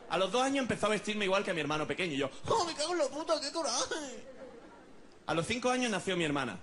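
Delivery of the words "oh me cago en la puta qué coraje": high, whiny mock outrage; exasperated child. Boy dressed like his brother, comically exasperated. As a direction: curse in a high, frustrated kid's voice. Same child, furious again.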